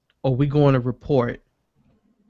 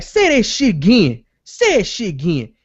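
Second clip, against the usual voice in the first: higher, louder retold challenge; angry confrontation; 6 semitones higher, 3 dB louder than usual. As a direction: call him out like you're squaring up. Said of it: "he's like".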